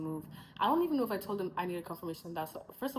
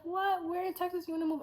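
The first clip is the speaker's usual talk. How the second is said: higher, mock-surprised girl's voice; surprised friend; 11 semitones higher than usual. The Texan girl asks where she'll move, surprised. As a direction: ask it as a startled, curious friend.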